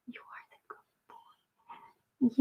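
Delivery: slow, soft whisper to a good dog; whispered pet praise. Real, not acted. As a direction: breathe the praise slowly to the pet.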